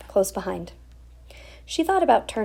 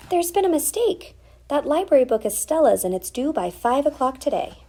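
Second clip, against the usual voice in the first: mother's voice; 8 semitones higher, 3 dB louder than usual. Acted. Mother explaining urgently.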